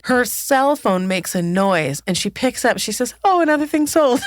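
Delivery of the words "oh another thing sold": high, louder retold pleased surprise; mock delight. Podcaster imitating her checking a sale alert, mock delight. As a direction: announce it in a raised, pleased voice.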